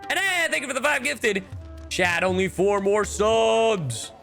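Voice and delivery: rally the chat, stretching the last word high; excited high-pitched thanks; excited hype. Real, not acted. Thanking gifters, hyping the chat.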